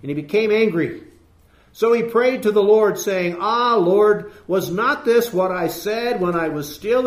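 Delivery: loud, rising scripture reading; preaching at full force. Acted. Preacher reading Jonah's angry prayer aloud, forceful. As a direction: read it like a thundering sermon.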